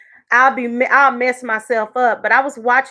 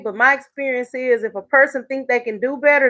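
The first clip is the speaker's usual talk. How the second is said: ordinary talk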